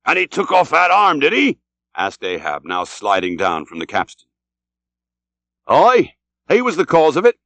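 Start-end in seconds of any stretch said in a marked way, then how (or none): 0.1-1.6 high, eager captain's question; Ahab questioning. A narrator's reading. 5.7-7.4 high, lively character voice in answer; hearty seafarer's reply, pitched up; English captain. A narrator's reading.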